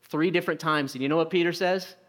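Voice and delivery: ordinary talk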